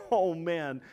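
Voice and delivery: ordinary delivery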